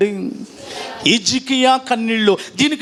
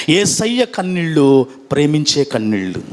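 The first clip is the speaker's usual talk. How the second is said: normal talking voice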